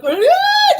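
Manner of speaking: high, loud imitated bawl; mock crying. Child sitting there crying, mock wail. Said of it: "just crying".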